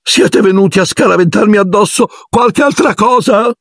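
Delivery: a wounded little ghost gurgling from her cubicle; pitiful gurgle. Acted. Ghost girl answering the boys from her stall, pitiful high gurgle. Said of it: "gorgogliò lei con una voce da far pietà".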